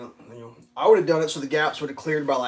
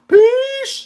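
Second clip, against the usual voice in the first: high, loud peace; shouted sign-off; 12 dB louder than usual. Real, not acted. Signing off to viewers with a loud high shout. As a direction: yell it out as you leave.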